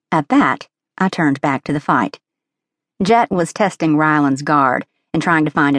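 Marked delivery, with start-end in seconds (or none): none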